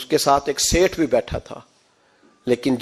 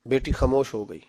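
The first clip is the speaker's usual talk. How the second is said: ordinary delivery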